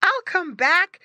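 high, loud piglet voice; little pig. A narrator's reading. Squinty to his family, high piglet voice. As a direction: promise it in a squeaky pig voice.

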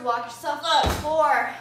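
loud coaching shout on the count. Real, not acted. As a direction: shout the rep number.

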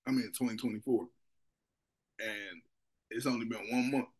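eating snack between sentences; slightly full mouth speech; talking while chewing. Real, not acted. Seen 3: holds snack throughout, mouth working between words; eating before and after.

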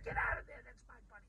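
a sudden protective yell; shout at a neighbor. Retold or imitated. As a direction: yell across the fence to shoo him off. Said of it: "I'd be like".

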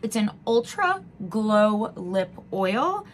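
usual speaking voice

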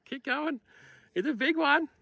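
bright, high-pitched cheering on; excited encouragement over a big catch; excited high call. Real, not acted. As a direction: cheer the angler on, voice jumping up. Excited guide hooks a big fish.

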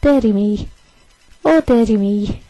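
light mock Scottish accent on dirty me; a playful Scottish lilt, half-laughing. Teasing her boyfriend's catchphrase in a hint of Scottish accent. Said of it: "in a Scottish accent as well".

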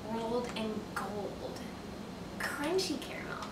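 breathy whispered treat name. Shown as a demonstration. Savouring the package words.

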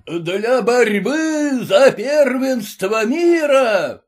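high, slow barked ringmaster call; barking announcement. A narrator's reading. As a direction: bark it out over the crowd. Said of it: "гаркнул дальнейшее".

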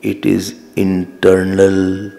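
low, very drawn-out delivery; slow, solemn emphasis. Real, not acted. Preacher correcting "external" to internal, slow and solemn. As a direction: let each word stretch out, pitched low. Slow dictation of key point.

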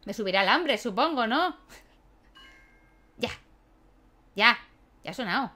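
ordinary talk

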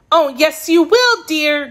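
put-on high, emphatic girlish voice; declaring girl. A narrator's reading. Girl overruling her kitten's refusal, declaring firmly. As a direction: insist firmly in a child's high pitch. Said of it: "Dorothy declared".

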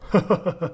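short, awkward fake chuckle; mock embarrassed laugh; sheepish laugh. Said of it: "되게 멋쩍게 웃더니".

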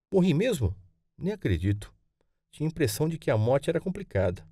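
lightly voiced boy, high opening question; a hint of a child in disbelief; surprised young grandson. Boy questions grandmother about his death, mild surprise.

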